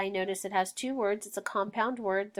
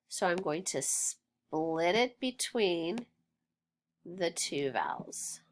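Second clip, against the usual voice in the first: ordinary delivery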